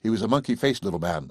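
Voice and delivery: normal talking voice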